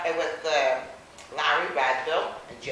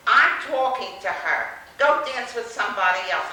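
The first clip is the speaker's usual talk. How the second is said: firm loud retort; 3 semitones higher, 10 dB louder than usual. Retold or imitated. Rival told off at dance.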